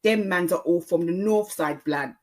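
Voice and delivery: Multicultural London English street accent; Jafaican accent. Shown as a demonstration. Podcaster demonstrating London youth talk, Jafaican accent. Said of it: "called a Jafaican accent".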